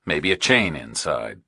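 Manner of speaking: usual speaking voice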